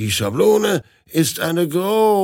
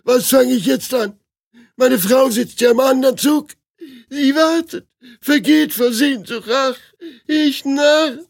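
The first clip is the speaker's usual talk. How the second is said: much higher, louder wailing despair; despairing lament; 12 semitones higher, 4 dB louder than usual. Acted. The groom laments his wife waiting on the other train, wailing. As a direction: wail over the waiting wife.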